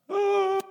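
high-pitched drawn-out "ooh" of dismay; mock-dismayed high "oh"; high apologetic "oh". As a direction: wince aloud in a high voice. Acting apology to knocked-over child.

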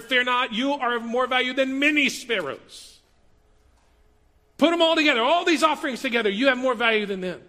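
preaching at full volume; full-force preaching. Acted. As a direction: build to a ringing declaration. Preaching builds to a climax.

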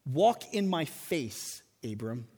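"Walk in my face, Abram" drawn out slow and hushed; slow hushed divine invitation; slow solemn reading. Shown as a demonstration. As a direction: intone it slowly and gently as God's call. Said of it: "Literally, this reads".

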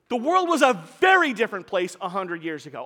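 "very" leaned on hard; stress on very. Shown as a demonstration. Preacher insisting to the congregation, stress on "very". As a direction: make "very" carry the point. Restating point with emphasis.

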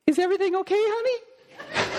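high, sweet mock wife voice; high falsetto imitation of the wife; wife's voice. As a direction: ask it like a sweet worried wife. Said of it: "Doreen says to me".

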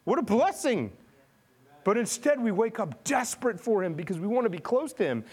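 full-force sermon delivery; impassioned preaching. Acted. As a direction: preach it with mounting fervor.

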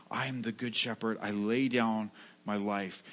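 hushed, heartfelt vow; sincere. Shown as a demonstration. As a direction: say it gently, as a promise from the heart. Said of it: "Jesus says this with all sincerity".

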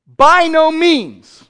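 slow, loud outraged cry; indignant Paul, slow and loud. Retold or imitated. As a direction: thunder it out in outrage. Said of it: "he was indignant. He was outraged".

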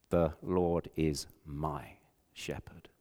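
soft slow whisper, almost breath; hushed whisper. Acted. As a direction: whisper it like a dying boy's prayer. Frozen boy's last words.

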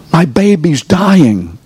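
preacher acting out a desperate parent; imitating grieving parent. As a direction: voice a parent in despair. Parent's grief imitated in sermon.

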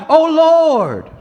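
loud, high, drawn-out cry to God. Retold or imitated. Psalmist cries out to the Lord, loud plea. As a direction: call out as if pleading with heaven. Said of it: "The psalmist cries out".

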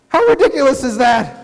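ordinary talk, nothing marked

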